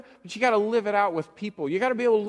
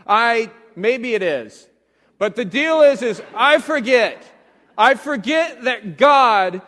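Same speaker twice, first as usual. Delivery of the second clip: preaching at full force; 2 semitones higher, 8 dB louder than usual. Acted.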